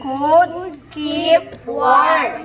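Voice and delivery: word by word, very slow; slow model reading. Shown as a demonstration. Teacher reading a story to class, slowly word by word. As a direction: stretch each word for learners to follow.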